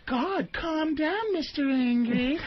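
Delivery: hammed-up dismissive taunt, mock whimpering; mocking dismissive woman. Comedian mocking a woman belittling her man, slow high sneer. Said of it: "that disregarding".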